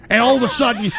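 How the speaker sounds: ordinary talk, nothing marked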